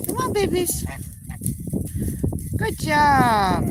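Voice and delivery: high, lilting encouragement; high coaxing call. Real, not acted. Owner praising geese in the water: high sing-song. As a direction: cheer them on like toddlers.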